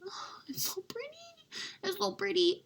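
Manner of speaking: breathless high whisper of awe; awed whisper. Real, not acted. Gushing over the palette in a high awed whisper. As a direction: whisper it like you're staring at treasure.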